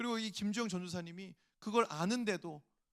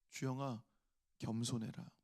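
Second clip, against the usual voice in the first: low, hushed divine voice; gentle voice of the Lord; 7 semitones lower, 3 dB quieter than usual. Retold or imitated. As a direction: speak it like God's quiet inner word. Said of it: "이런 말씀을 주시는 거예요". (낮고 조용한 주님의 음성)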